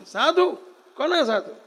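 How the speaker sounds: sneering high-pitched challenge; mocking rough ruler; scoffing bandit prince. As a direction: scoff at the monks like a proud bandit.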